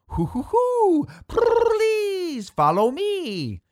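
very high, slow cartoon rabbit laugh and call; squeaky toon hoot, drawn out slowly; Roger Rabbit voice. A narrator's reading. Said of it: "That voice sounds like Roger Rabbit".

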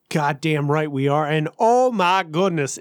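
ordinary delivery

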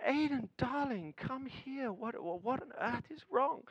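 hushed, tender father at bedtime; tender dad. Retold or imitated. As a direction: gently call a distraught son over. Father soothing upset son.

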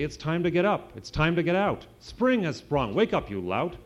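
shouting wake-up call. A narrator's reading. Cat Chester waking the lazy dog, loud call. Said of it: "he kept shouting".

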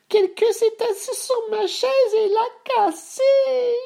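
slow, extremely high wail; whimpering baby bear. A narrator's reading. Bébé Ours holding his broken chair, high whimper. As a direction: whine it out in a tiny voice. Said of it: "pleurnicha Bébé Ours".

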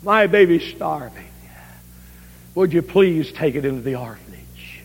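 faint whispered begging mother; breathy hushed plea for the baby. Retold or imitated. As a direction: whisper it like a weak starving mother.

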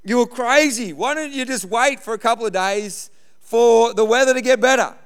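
high, loud imitation of an alarmed dad; mock freak-out. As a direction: act out a panicked warning, loud and high-pitched. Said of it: "having a, a freak out".

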